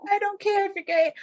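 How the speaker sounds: high, far sing-song mom line. Retold or imitated. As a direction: chirp it high and sweet. Mom to kids, acted quote.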